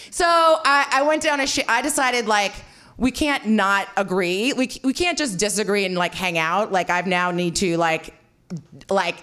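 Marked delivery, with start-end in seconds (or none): none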